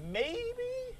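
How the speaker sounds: squeaky high maybe, hopeful; mock hopeful squeak. Preacher mocking parent hoping the odds hold, squeaky high. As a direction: pretend to hope against the odds.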